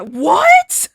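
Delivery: loud, high, drawn-out outburst; shocked yell. Real, not acted. Shopper discovers Louis Vuitton prices, shocked yell. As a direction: blurt it out in loud disbelief.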